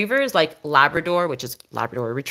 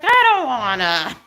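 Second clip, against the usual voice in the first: sulky dog voice; 6 semitones higher, 4 dB louder than usual. Retold or imitated. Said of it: "She's like".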